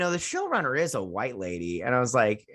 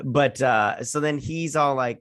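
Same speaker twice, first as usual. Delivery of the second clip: ordinary delivery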